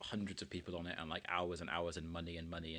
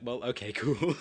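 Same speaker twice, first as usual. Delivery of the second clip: laughing; 4 semitones higher, 12 dB louder, slower than usual. Retold or imitated. Retold reply, laughing.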